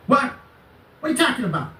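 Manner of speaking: high-pitched, frantic interrupting squawk; mock screaming. Cutting off the supposed lawyer, high mock screaming. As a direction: imitate a man screaming over the caller. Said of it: "he was just screaming, right? That's how he sounded".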